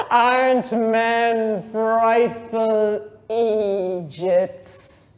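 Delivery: slow, drawn-out mocking lament. A narrator's reading. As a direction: stretch it out, teasing.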